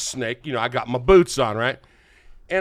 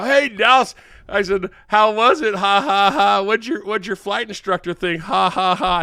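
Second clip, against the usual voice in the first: loud, higher mock-gloating phone greeting; retold teasing; 6 semitones higher, 5 dB louder than usual. Teller to buddy on the phone about his flight, retold teasing. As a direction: rib a buddy with spoken ha-ha-has.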